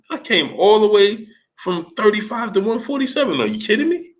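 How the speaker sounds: high, incredulous mock Manny voice climbing to a squeak; imitating Manny. Host imitates Manny bragging about moving up weight, high incredulous. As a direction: sell the disbelief, push the last line way up.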